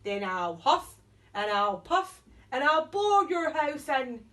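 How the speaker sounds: loud, blustering wolf; wolf shout. Acted. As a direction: bellow the threat. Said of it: "shouted the wolf".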